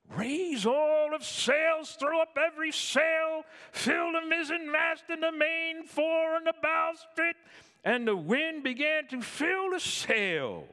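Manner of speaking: high, loud captain's command; shouted order. Retold or imitated. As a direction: bellow the order across the deck. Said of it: "he gave the order".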